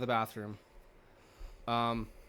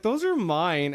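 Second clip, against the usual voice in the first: indignant claim; 6 semitones higher, 7 dB louder than usual. Retold or imitated.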